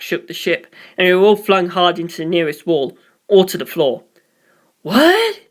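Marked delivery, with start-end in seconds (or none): 4.8-5.4 sharp high shouted What?. A narrator's reading. Polka Loco yells in shock after the impact, loud. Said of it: "Polka Loco shouted".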